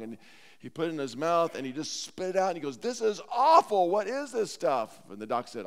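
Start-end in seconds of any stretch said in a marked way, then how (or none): 2.8-5.0 loud, high, drawn-out revulsion; hammed-up disgusted outburst; acted disgust. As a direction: spit the words out in mock horror. Joe spits out the foul sample.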